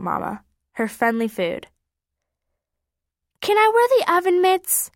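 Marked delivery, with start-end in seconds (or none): 3.4-4.9 put-on high eager child asking; little boy voice. A narrator's reading. Little brother begging Mama for oven mitts, high eager voice. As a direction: ask brightly like a six-year-old.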